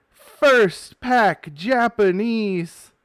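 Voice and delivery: loud, high and very slow, each word dragged; stunned shout. Real, not acted. Telling viewers about the pull, awed loud slow shout. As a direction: drag out "First pack" in awe. Rare pull in first pack.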